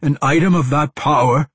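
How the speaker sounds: loud, high-pitched indignant question; incredulous. A narrator's reading. Agitated man leaping from his seat, incredulous scolding. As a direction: scold in sheer disbelief. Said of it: "his voice incredulous".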